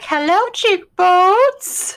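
high, slow, drawn-out delight. Retold or imitated. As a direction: savour the greeting, slowly and high. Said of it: "And you're like".

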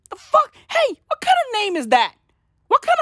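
high, fast, indignant put-on voice; squeaky outraged voice. Comedian mocking the name, high squeaky outrage. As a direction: squeal it in mock disbelief.

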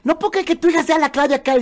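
high-pitched disbelief; amazed friend. Retold or imitated. Family friends to the father, amazed high disbelief. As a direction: marvel at the news. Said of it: "los amigos te decían".

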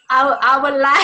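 Joey Tribbiani. Pretend. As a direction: have a halting go at a New York accent. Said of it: "channel my inner Joey Tribbiani".

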